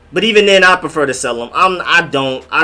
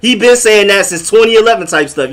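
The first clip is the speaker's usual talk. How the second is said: higher, insistent imitation of a New Yorker; lightly voiced New York boaster, pitched up; imitating New Yorker; 6 semitones higher, 3 dB louder than usual. New Yorker claiming they created the slang, mock insistence. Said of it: "Now New Yorkers are using it".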